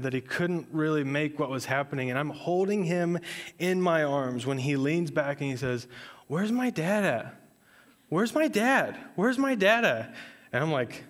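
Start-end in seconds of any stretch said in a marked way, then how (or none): 6.3-10.1 put-on a groggy toddler calling for his dad; confused toddler. Sick toddler in his dad's arms, high confused plea.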